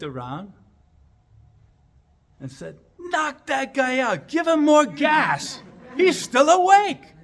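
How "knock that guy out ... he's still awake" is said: high, loud, slow panicked shouting; urgent shout. Retold or imitated. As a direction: yell the order across the room. Surgeon panics, patient awake.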